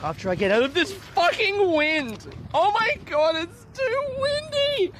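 high, hammed-up wail, stretching at the end; mock wailing complaint. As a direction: whine it skyward in mock despair. Wailing about the wind.

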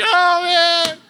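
high, slow hammed-up blubbering; comic tearful voice, way up in pitch; mock weepy gushing. Oversharer gushing to the 7-Eleven cashier, mock weepy.

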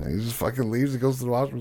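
usual speaking voice

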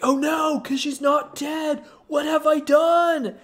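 the priest realizing his mistake, crying out; panicked shout. Retold or imitated. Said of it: "the priest's like".

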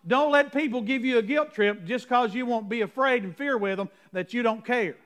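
ordinary talk, nothing marked

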